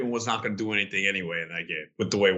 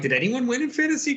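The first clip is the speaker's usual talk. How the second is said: mock question; 14 semitones higher than usual. Quoting his own social post.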